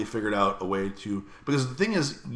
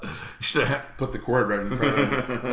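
ordinary delivery

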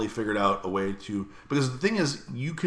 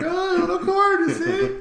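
much higher, louder taunt through laughter; laughing mock taunt; 7 dB louder, slower than usual. Taunting the cord-eating cat, high and laughing. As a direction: cackle at the cat as you taunt it.

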